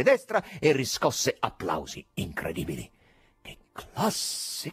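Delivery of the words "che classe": breathless unvoiced near-whisper of delight; rapt hush. A narrator's reading. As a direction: gasp it out, awed. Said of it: "gridavano rapiti".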